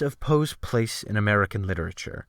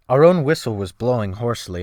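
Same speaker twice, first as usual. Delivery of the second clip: ordinary delivery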